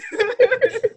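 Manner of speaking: short high nervous giggle; nervous laugh. Real, not acted. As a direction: giggle shyly, embarrassed. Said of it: "she got the nervous laugh".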